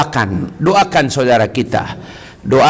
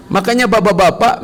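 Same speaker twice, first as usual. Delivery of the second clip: ordinary delivery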